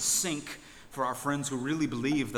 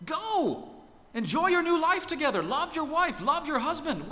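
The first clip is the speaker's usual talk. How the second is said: loud, much higher, exasperated scolding; exasperated outburst; 7 semitones higher, 5 dB louder than usual. Retold or imitated. The minister scolding the honeymoon couple, loud and exasperated. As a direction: throw up your hands and scold them, rising to a yell. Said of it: "a sigh of exasperation and he says".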